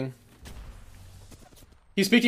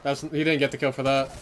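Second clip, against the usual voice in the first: usual speaking voice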